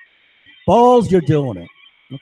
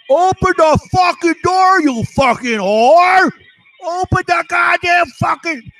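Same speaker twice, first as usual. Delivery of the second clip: high, loud, drawn-out bellow in mimicry; imitated yelling father; 13 semitones higher, 5 dB louder, slower than usual. Father locked out by his kid, high mock yelling. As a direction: act out a father shouting, stretching the words. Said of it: "they're yelling back and forth".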